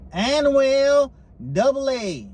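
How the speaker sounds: slow, high Southern announcer drawl; hammed-up Southern twang; Southern accent. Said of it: "That's a good Southern accent".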